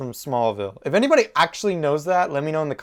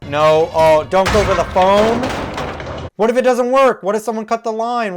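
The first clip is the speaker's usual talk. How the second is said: loud, high, worried outburst; worried protest, pitched up; alarmed warning; 6 semitones higher, 5 dB louder than usual. Real, not acted. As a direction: warn the character off in alarm. Urging character away from phone.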